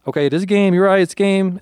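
high, loud, rushed reassurance; woman panicking at gunpoint, shouting to placate him; frantic pleading. Retold or imitated. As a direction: blurt it out to calm an armed man. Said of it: "she's like".

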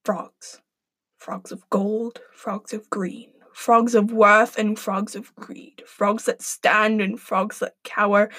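slow, hushed, spooky chanting; eerie incantation. Acted. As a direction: intone it like a spell. Eerie pre-recorded frog chant.

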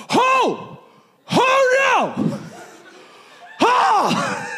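high, loud frightened shrieks; not the most masculine reaction to a giant snake, shrieking; panicked yelps. Retold or imitated. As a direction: squeal like you've just seen a snake. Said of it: "my response was not the most masculine".

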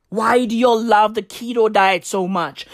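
ordinary delivery

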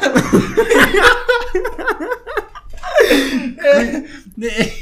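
loud, high, helpless laughter; real long belly laugh; long high laughing fit. Real, not acted. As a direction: burst out laughing and keep going. Long laugh after punchline.

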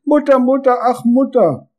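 high wailing cry for mother; a child's shrill call for mother. A narrator's reading. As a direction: wail it like a scared little boy. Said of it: "Hannes begann zu schreien".

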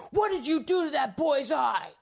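loud, high retold cop's yell; acted outraged shout. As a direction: bellow an accusation, imitating an angry cop. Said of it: "And started yelling at me".

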